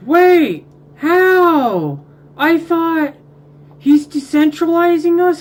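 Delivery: high, loud disbelieving yells; outraged shouting. Real, not acted. Player yelling at the game over his lost empire, furious and loud. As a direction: shout at the screen in real outrage.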